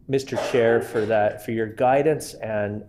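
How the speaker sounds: normal talking voice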